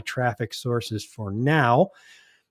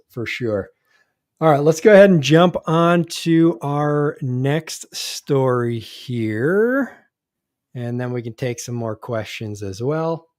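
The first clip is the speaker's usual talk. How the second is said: ordinary talk, nothing marked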